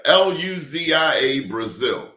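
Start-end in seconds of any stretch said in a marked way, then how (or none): none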